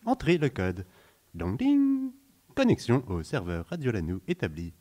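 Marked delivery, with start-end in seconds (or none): 1.4-2.1 sung startup chime. Acted.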